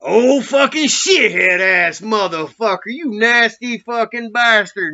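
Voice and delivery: high, loud, slow mock-disgusted cursing; gleeful insult. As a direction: drag out the insult with delight. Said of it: "my dude's like".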